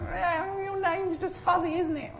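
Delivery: very high, soft, teasing cat voice; high squeaky cat teasing; mocking cat voice. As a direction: needle the pompous cat with a squeaky sneer. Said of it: "they're like".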